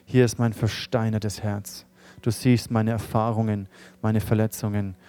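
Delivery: soft, hushed prayer for the room to repeat; quiet led prayer. Acted. Pastor leading the congregation in a confession prayer, hushed and earnest. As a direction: pray it gently, line by line, for others to echo.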